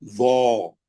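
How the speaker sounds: वो as a heavy "zaw", low and rounded; thick. Shown as a demonstration. Teacher sets heavy against thin for students, thick and low. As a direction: round the mouth on a buzzing, heavy वो. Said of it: "सात हरफों को मोटा करने".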